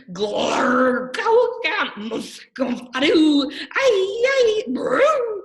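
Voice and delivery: wild squealing nonsense noises, high and loud; silly sounds. Acted. Reader bursts into goofy noises for the kids. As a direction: gargle and squeal the gibberish. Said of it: "make silly sounds like".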